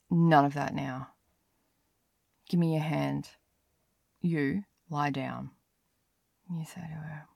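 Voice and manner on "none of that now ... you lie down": stern. Acted. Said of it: "so stern with her".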